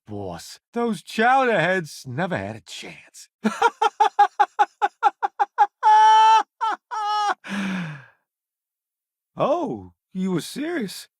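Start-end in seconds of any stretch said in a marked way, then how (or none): 3.4-8.0 long high triumphant laugh; gloating laugh. Acted. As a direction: cackle over the beaten foes. Gloating after victory.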